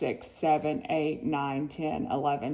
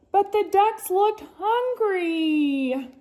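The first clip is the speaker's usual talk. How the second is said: high pleading; 12 semitones higher, 4 dB louder, slower than usual. A narrator's reading. Storyteller pleading for ducks.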